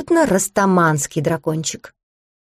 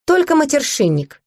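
a mild laughing snort, pitch jumping higher at the end; a touch of laughter in the question; 5 semitones higher than usual. A narrator's reading. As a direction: hold back a giggle as you ask. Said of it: "фыркнула я от смеха".

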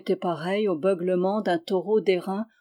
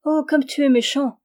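bright reproach, higher and louder; playful cry; 6 semitones higher, 5 dB louder than usual. Acted. Rose Air, blushing, cries out at the kisser. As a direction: cry out in mock outrage. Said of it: "s'écria Rose Air".

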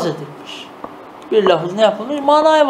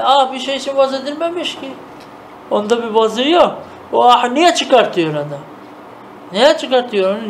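normal talking voice

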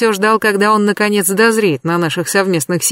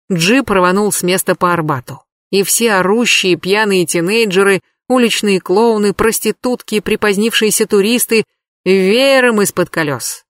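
plain reading voice

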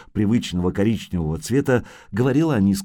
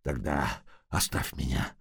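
very soft, lower, fading moan; dying man's faint plea; 8 semitones lower, 11 dB quieter than usual. Acted. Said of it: "простонал раненый". (слабый стон умирающего)

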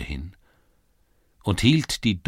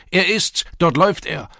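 excited shout; 12 semitones higher, 8 dB louder than usual. A narrator's reading. Crowd spots the thief.

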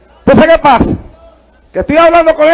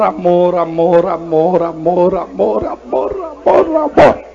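mocking repetition; 8 semitones lower, 5 dB quieter, slower than usual. Mocking endless talk of love.

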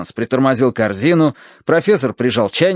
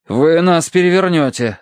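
raised, urgent protest; alarmed warning; 6 semitones higher than usual. A narrator's reading. Narrator warns Terence climbing aboard, alarmed. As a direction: warn him in a higher voice.

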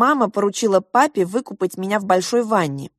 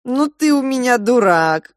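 higher, louder affectionate yell; mock shout; 5 semitones higher, 4 dB louder than usual. Mother shouting back fondly at the father. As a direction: scold him fondly, half shouting. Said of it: "крикнула мама в ответ".

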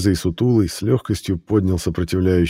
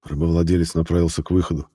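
ordinary delivery, nothing marked

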